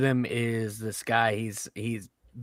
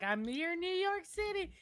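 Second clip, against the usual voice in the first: very high, drawn-out mock tourist voice, softer; imitated tourist lady; 7 dB quieter than usual. Redoing the tourist lady more softly after complaint, high squeal. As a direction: squeal it slowly without volume. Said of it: "so after she's like".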